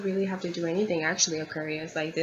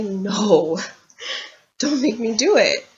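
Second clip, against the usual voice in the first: mock child crying; 3 semitones higher, 5 dB louder than usual. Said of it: "I was like a child crying".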